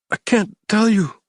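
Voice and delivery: high, frightened whimper; a scared captive begging under a gun. A narrator's reading. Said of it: "he whimpered".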